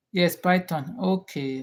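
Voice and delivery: usual speaking voice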